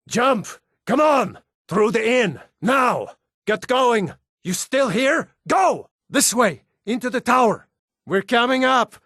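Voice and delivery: high, urgent calls to run; urgent shouting. Acted. Fleeing the dragon, shouting at the prisoner to hurry. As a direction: yell orders to get them moving now. Urging escape during dragon attack.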